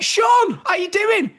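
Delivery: surprised reunion greeting in the arena; excited greeting. Retold or imitated. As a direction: greet a long-lost mate with glee. Greeting old friend with surprise.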